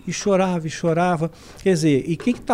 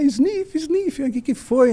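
high, slow fake sniffles; imitating tearful wife; mock sniffling; 8 semitones higher, much slower than usual. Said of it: "meio que chorando".

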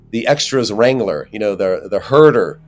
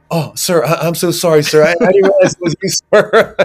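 higher and louder, fast embarrassed babble with a laugh; flustered apology; 7 semitones higher, 5 dB louder than usual. Retold or imitated. Wrangler realizing he is the actor, flustered and apologetic. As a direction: gush apologies in a hurry.

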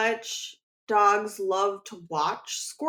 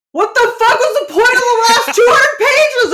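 loud, high wailing yell; incredulous screamed complaint; exasperated outburst; 11 semitones higher, 12 dB louder than usual. Pretend. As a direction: shriek it in hammed-up reader outrage. Acts out reader's outrage at book.

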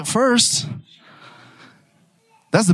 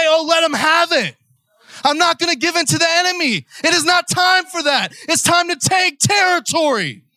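raised, shouted sermon lines; forceful preaching; 6 semitones higher than usual. Acted. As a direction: preach it like a battle cry.

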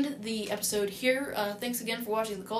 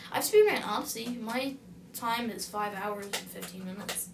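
ordinary talk

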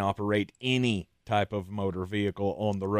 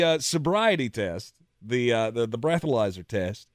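ordinary talk

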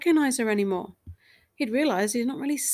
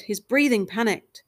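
ordinary delivery

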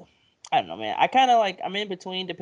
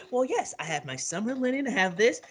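high-pitched; 7 semitones higher, 4 dB quieter than usual. Retold or imitated. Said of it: "he was like".

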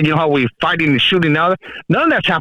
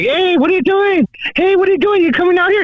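mock flirty woman; 12 semitones higher than usual. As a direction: play the eager women calling him out. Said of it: "and they're like".

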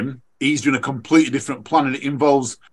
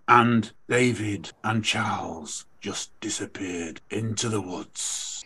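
slow, low and much softer, fading away; ominous podcast narrator; 6 semitones lower, 9 dB quieter, slower than usual. Retold or imitated. Runner quoting the spooky podcast line, low and slow. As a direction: lower and slower, like a spooky storyteller. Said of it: "it goes".

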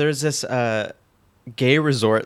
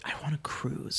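whisper; 3 semitones higher, 14 dB quieter than usual. Retold or imitated. Retelling own secret wish.

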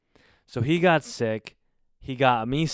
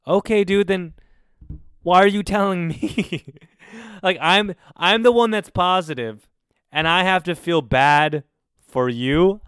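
higher and louder, incredulous; indignant outburst; 6 semitones higher, 6 dB louder than usual. Real, not acted. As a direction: fire back in disbelief at the selfish roommate. Indignant at roommate's selfishness.

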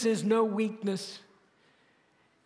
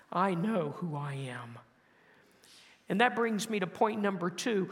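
normal talking voice

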